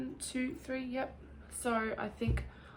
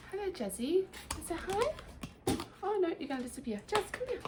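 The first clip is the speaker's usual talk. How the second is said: high sing-song pet talk; 10 semitones higher than usual. Real, not acted. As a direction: coo at the pet sweetly. Talking to pet Jazzy.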